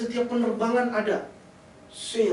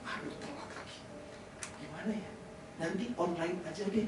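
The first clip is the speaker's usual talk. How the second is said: much softer half-whispered groan of someone who overslept; sleepy late riser; 6 semitones lower, 13 dB quieter than usual. Pretend. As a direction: grumble it under your breath, just woken. Oversleeping churchgoer voiced.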